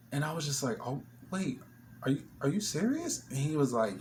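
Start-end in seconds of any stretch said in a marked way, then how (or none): 0.9-3.2 high, stunned are you serious; shocked disbelief. Retold or imitated. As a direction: voice jumps way up in disbelief. Shocked reply to jail news.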